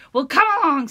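very loud, bold big goat; loud challenge. Acted. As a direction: call him out loudly.

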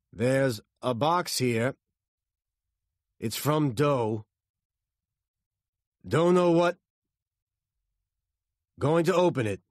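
radio report. A narrator's reading. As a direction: call it through radio noise. Shouting through radio noise.

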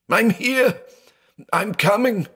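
high, desperate calling out; shrieked. A narrator's reading. Blind man promising his trapped sister rescue, shrieked. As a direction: cry out to reach someone, voice pitched far up. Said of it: "he shrieked".

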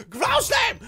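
high, loud battle yell; yelled attack call. Real, not acted. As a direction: yell the move as you strike. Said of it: "how many times I've yelled ground slam".

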